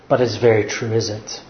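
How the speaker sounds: ordinary talk, nothing marked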